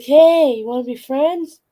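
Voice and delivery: high, eager cat voice; friendly cat. Retold or imitated. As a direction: playfully imitate a sociable cat making friends. Voices friendly cat greeting newcomer.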